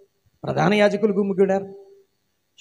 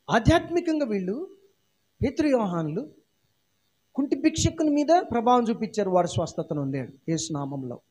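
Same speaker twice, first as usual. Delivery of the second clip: ordinary talk, nothing marked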